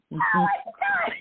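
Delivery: high, slow wail of disbelief through laughter; laughing wail. Real, not acted. Remembering mom telling the manager to jail him, a laughing wail. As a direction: howl it, cracking up at the memory.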